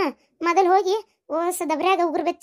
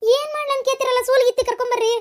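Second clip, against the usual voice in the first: high, urgent calling; panicked summons, pitched high; alarmed; 6 semitones higher than usual. Acted. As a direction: call for help in a panic. Mother sends him for midwife.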